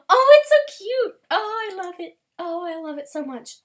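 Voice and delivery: high loud gushing over cute art; delighted squeal. Real, not acted. Toga fan art delight.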